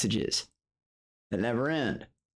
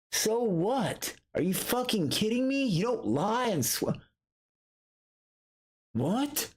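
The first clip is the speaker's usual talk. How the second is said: indignant outrage; 10 semitones higher than usual. Real, not acted. Outraged at lying while swearing.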